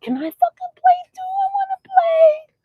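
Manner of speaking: voicing the cat's plea, very high; squeaky pleading kitty voice; high whiny cat voice begging to play; cat's inner voice. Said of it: "She's looking at them like".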